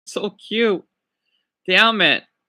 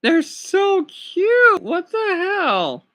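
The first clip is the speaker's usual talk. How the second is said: high, slow, drawn-out coo; 9 semitones higher, much slower than usual. Real, not acted. Streamer melting over a dog photo, slow high cooing. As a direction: melt over the puppy picture.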